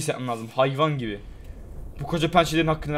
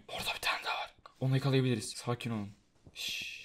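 hushed hunting; 4 semitones lower, 11 dB quieter than usual. Real, not acted. Sneaking up on prey.

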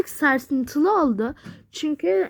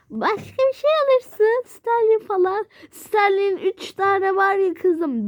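high pleading; 7 semitones higher than usual. Real, not acted. Bargaining over gifts.

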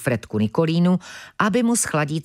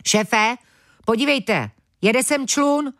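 calling out; 7 semitones higher than usual. Acted. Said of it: "zvolal najednou jeden z banditů".

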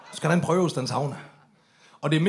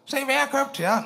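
cheerful Laura; 9 semitones higher than usual. Retold or imitated.

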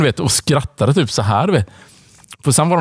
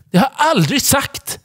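mock denial; 12 semitones higher than usual. Retold denial, shrill.

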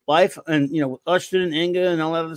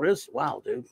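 normal talking voice

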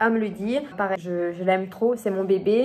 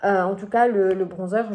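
ordinary talk

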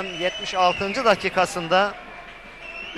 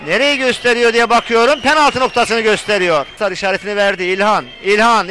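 loud football commentator; 8 dB louder than usual. Real, not acted. Waiting for the referee's decision.

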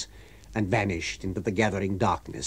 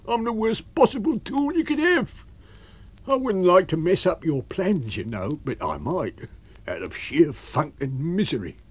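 miserable tramp; 8 semitones higher than usual. A narrator's reading. Tramp talking down his usefulness to his master, miserable. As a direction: whine high, then sink toward misery.

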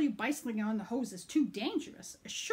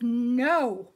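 much louder flat refusal; firm no; 11 dB louder than usual. Acted. Mom refusing son, loud firm no. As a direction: shut it down hard. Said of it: "She says".

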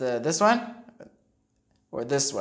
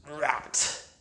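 usual speaking voice

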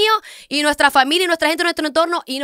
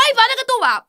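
much higher sing-song mock of her; mocking imitation; 9 semitones higher than usual. Mocking her co-host's remark, high mock voice. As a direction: pitch it up to mock her words.

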